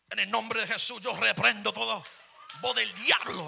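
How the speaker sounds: loud, shouted Spanish prayer; Pentecostal rebuke. Retold or imitated. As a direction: rebuke the devil in tongues. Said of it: "I got real Pentecostal, real Spanish about it".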